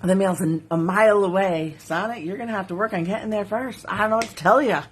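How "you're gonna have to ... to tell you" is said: usual speaking voice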